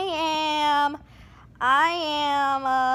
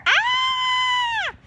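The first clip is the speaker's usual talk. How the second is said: high playful fright scream; mock scream. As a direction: shriek as if scared. Scared by tiger roar.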